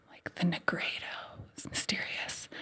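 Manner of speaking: hushed, whispered awe at the word; mysterious whisper. Acted. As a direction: whisper it as if it were a dark secret.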